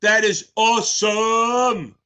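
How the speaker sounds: high, very drawn-out cheer; elated praise. Real, not acted. Streamer congratulating Lemonade on passing, elated praise. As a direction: stretch every word in celebration.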